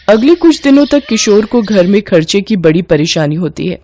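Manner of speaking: usual speaking voice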